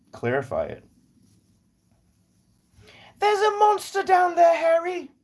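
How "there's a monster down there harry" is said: put-on very high, loud falsetto English accent; hammed-up, high-pitched London accent; Harry Potter character. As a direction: cry a warning in a squeaky British voice.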